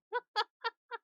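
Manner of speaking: bursting out laughing, high; a sudden high laugh. Real, not acted. Laughing at the announcement.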